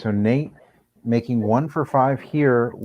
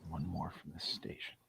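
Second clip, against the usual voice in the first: golf commentator whisper; 6 semitones lower, 24 dB quieter than usual. Acted. Hushed putting commentary.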